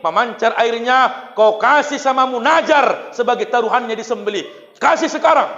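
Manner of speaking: shouted summons to pay a debt; loud calling out. Retold or imitated. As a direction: call him to account, forceful and high. Acted call demanding the promised vow.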